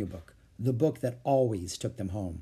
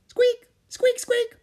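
very high, loud little squeaks; urgent mouse squeaks; 7 dB louder, much slower than usual. Acted. Peanut wanting to help Annie, squeaking insistently. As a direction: squeal repeatedly like a tiny mouse.

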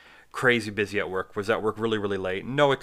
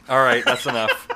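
laughing; 9 dB louder than usual. Real, not acted. Laughing off own rant.

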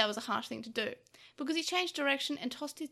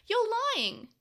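high, louder stunned protest; stunned denial; 9 semitones higher, 5 dB louder than usual. A narrator's reading. Boy hearing his brother spoke ill of him, stunned denial. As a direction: burst out in disbelief. Stunned disbelief at bad news.

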